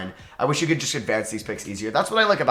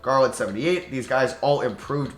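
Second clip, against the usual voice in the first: usual speaking voice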